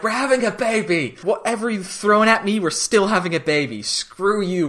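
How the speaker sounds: high-pitched, exultant defiance at normal volume; imitating a giddy, defiant boast; mock defiant elation. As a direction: crow it at the heavens. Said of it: "Just looking up at the galaxy".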